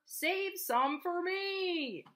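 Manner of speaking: high, slow drawn-out plea; farmer pleading. A narrator's reading. Farmer begging the horse, slow high plea. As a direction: stretch it out begging. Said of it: "cried the farmer".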